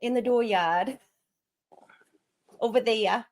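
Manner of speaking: broad, drawn-out New England accent. Shown as a demonstration. Showing off an easy accent, exaggerated New England. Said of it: "easy to do a New England one".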